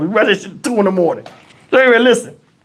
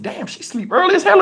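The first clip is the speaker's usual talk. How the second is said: much higher-pitched, amazed aside; mock astonishment, pitched way up; retold amazement; 11 semitones higher than usual. Boyfriend noticing she's snoring already, high-pitched mock surprise.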